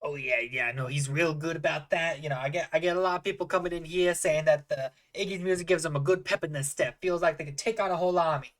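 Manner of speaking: folksy barkeep praising the house musician; chatty halfling bartender. Acted. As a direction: talk up your performer to a customer.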